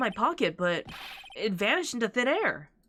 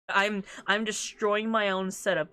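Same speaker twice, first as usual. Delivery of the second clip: ordinary delivery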